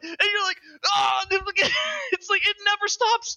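very high, strained cry-shout; yelling with delight at the game; near-screaming excitement. Real, not acted. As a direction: top of the voice with joy. Peak of excited rant.

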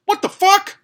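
high, loud, stunned cry; shocked outburst. Retold or imitated. Narrator reacting to the teen's insult, loud and shocked. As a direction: blurt it out in disbelief. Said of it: "I was like".

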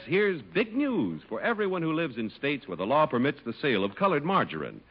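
ordinary delivery

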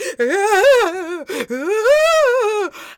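long, high, loud wail; hammed-up wordless crying; mock breakdown wail. Mom imitates daughter past answering, mock wailing. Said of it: "verbally starting to just, like, break down".

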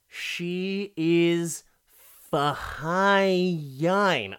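very slow, drawn-out "fine"; appreciative, stretched-out praise; admiring drawl. Real, not acted. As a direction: linger on how good she looks. Admiring the actress's looks.

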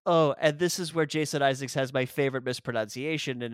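ordinary delivery

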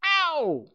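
very high, loud yelp; crying out "ow". A narrator's reading. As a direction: squeal in protest at being scrubbed. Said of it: "cried Piglet".

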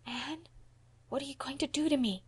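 put-on small, high, hushed child's voice; whispered. A narrator's reading. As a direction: ask softly, dreading the punishment. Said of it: "he whispered".

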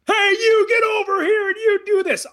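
high-pitched demo of a yelling parent. Shown as a demonstration. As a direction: holler an order at your kid. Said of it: "hear a parent yell at you like that".

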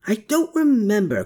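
high-pitched, restrained yell; narrated yell. A narrator's reading. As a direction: yell it without much extra volume. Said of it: "Klaus yelled".

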